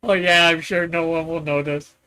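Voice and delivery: ordinary talk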